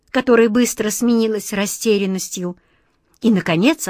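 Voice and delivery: ordinary delivery, nothing marked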